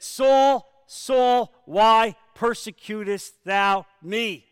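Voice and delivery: loud, high booming divine voice; slow, loud voice of the Lord calling Saul; voice of Jesus. Acted. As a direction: call down like thunder from heaven. Voice of Jesus from heaven.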